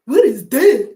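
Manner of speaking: put-on loud, baffled voice of a kid seeing a cassette; puzzled young viewer. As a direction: act out a confused youngster holding a tape. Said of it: "You're like".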